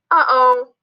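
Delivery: put-on childlike oops moment; dismayed little character. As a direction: realise your slip in a small high voice. Z realises its mistake.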